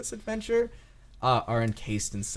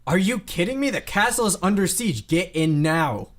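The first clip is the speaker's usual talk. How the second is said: high, loud, exasperated order; urgent shout; 7 semitones higher, 7 dB louder than usual. Acted. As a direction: yell at stragglers to take cover, dragging the last words. Acted guard during a siege.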